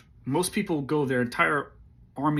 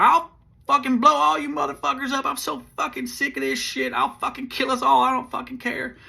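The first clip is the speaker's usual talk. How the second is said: screaming high and loud, at the end of his rope; a cornered kid yelling he'll kill everyone; unhinged threat; 12 semitones higher, 5 dB louder than usual. Retold or imitated. T with a pulled grenade, shrieking at the squad in a high frantic threat.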